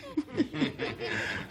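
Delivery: a high, breathy real laugh at his own story. Real, not acted. Preacher laughing at his own story, high and breathy.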